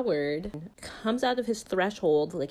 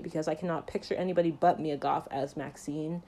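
usual speaking voice

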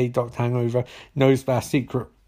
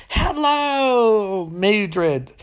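high, long drawn-out hello sliding down; mock-sinister singsong hail; hammed-up villain greeting; 7 semitones higher, much slower than usual. Charlotte greeting Mildred at the door, hammed-up.

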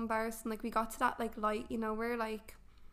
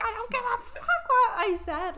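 much higher and louder furious yell; retold yell; 12 semitones higher, 8 dB louder than usual. Yelling at her family mid-labour, much higher and louder. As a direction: let it rip at the whole family.

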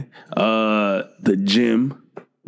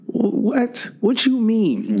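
high-pitched mock bewilderment; baffled reply; 11 semitones higher, slower than usual. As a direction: stammer it out in a high, confused voice. Retold bewildered reply to sister.

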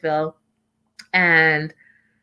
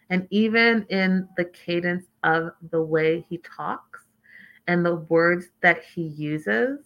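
slow, measured, kind of creepy; creepily careful, slowed-down delivery; deliberate cadence; 3 semitones lower, 3 dB quieter, slower than usual. Shown as a demonstration. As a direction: space the words out deliberately. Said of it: "see how I'm talking like that?".

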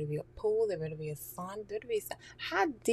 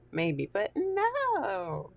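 drawn-out tease; 5 dB louder, much slower than usual. Real, not acted. Teasing self-correction.

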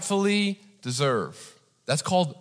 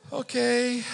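high-pitched, small okay; mock meek reply; 9 semitones higher than usual. Driver answering the officer's jibe, mock meek. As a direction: give in with a tiny high voice.

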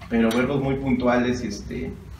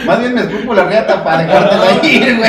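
loud, laughing crude joke; 6 semitones higher, 8 dB louder than usual. Real, not acted. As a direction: crack up while saying it. Joking crude comeback, laughing.

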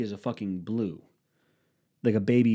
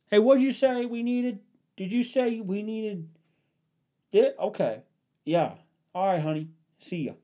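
high-pitched, raised phone-call voice; imitated husband phoning his wife; 12 semitones higher than usual. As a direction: mimic a guy calling home from the store. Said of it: "I saw two guys call their mo-, call their wives".

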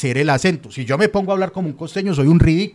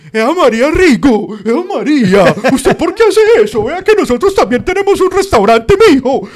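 hammed-up backcountry paisa accent, much higher and louder; exaggerated paisa montañero accent; 8 semitones higher, 8 dB louder than usual. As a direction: overplay a dopey mountain paisa accent, almost shouting. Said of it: "ese es como de acento paisa montañero bobo". (acento paisa montañero exagerado, agudo y fuerte)

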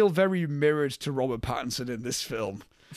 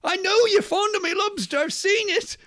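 indignant denial, imitated at a much higher pitch; Willem Dafoe; 4 dB louder than usual. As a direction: protest in disbelief, hammed-up character voice. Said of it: "in denial that someone could be so".

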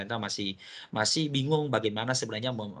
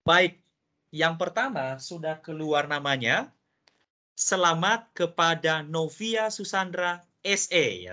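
upbeat, higher-pitched prize call; booming prize announcer; 6 semitones higher, 4 dB louder, slower than usual. Acted. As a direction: announce it like a game show host. Host reveals first winner.

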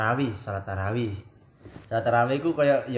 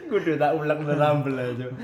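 laughing; 4 semitones higher, 4 dB louder than usual. Real, not acted. Joke about grinding in sambal.

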